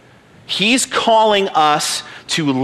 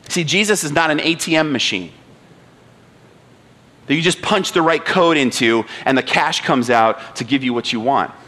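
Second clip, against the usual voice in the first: usual speaking voice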